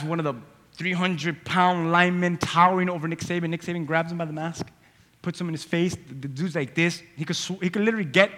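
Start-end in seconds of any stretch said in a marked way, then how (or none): none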